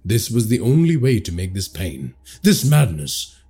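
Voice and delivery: usual speaking voice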